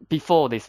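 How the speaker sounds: "Before" hit hard and loud, falling; emphatic before. Shown as a demonstration. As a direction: slam the word "Before" for the class. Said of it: "So remember".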